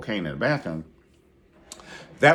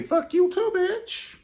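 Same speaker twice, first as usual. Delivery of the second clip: much higher, loud mock retort rising at the end; shrill falsetto comeback; 3 dB louder, slower than usual. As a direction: squeal it back at her. Retorting to the insult.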